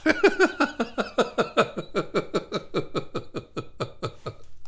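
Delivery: long laugh. Real, not acted. Amused by a viewer's puzzling comment, long laugh. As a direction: let the laugh roll out.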